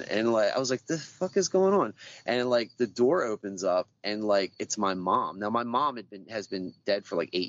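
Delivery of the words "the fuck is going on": much higher, louder startled outburst; alarmed disbelief. Retold or imitated. Retelling the moment he saw himself young, higher startled outburst. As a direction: blurt it out, shocked. Confused waking as a child.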